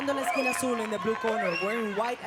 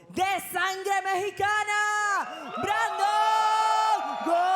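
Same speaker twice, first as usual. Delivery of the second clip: drawn-out, higher shouted name; announcer shout; 8 semitones higher, 5 dB louder, slower than usual. Real, not acted. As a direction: stretch the winner's name out. Announcer introduces the winner.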